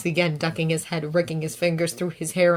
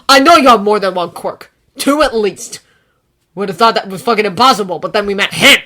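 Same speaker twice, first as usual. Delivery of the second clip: angry; 3 semitones higher, 12 dB louder than usual. A narrator's reading. Bakugo confronting Izuku.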